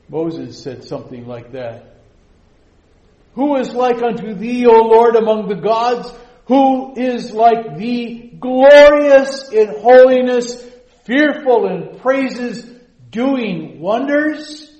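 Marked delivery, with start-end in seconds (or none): 3.3-14.7 loud, high and slow, proclaiming in awe; full-force preaching. Acted. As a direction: thunder it out like a psalm of praise. Quoting Moses at full force.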